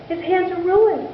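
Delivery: high, worried lament; a mother beside herself with worry; lightly voiced fretting mother; distraught mother. A narrator's reading. Distraught mother laments son's hands.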